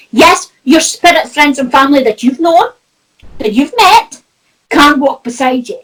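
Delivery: ordinary talk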